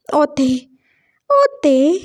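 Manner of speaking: ओते with t for k; mocking lisp. Boyfriend laughs at lisping boy, mimicking ओते. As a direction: imitate the lisped "okay". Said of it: "वो फिर से हंसा था".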